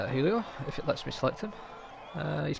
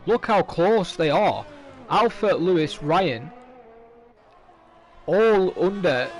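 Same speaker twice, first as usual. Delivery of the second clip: excited; 3 semitones higher, 7 dB louder, much slower than usual. Real, not acted. Commentator excited by close times.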